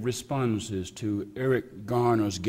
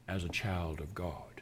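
hushed; 5 semitones lower, 11 dB quieter than usual. Real, not acted. Preacher's solemn close.

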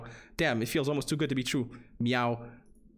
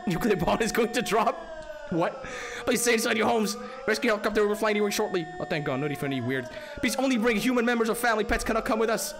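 higher, louder emergency broadcast; alert announcer voice; 8 semitones higher, 3 dB louder than usual. Acted. Streamer voicing the rescue alert, loud and high. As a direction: bark it like an evacuation alert. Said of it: "Yes, alert".

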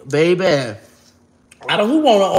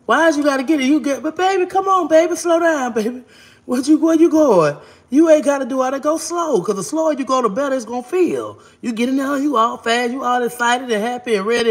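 put-on much higher, sing-song coaxing baby talk; coaxing lover; 7 semitones higher than usual. Acting to lover, slow down.

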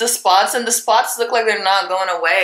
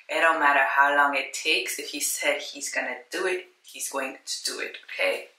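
much softer, almost breathed; quiet sure faith; hushed conviction; 3 semitones lower, 6 dB quieter than usual. Real, not acted. Faith that God delivers.

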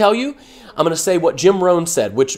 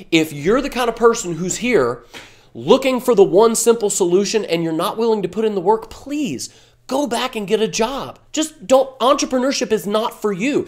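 usual speaking voice

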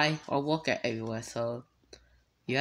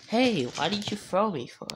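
ordinary delivery

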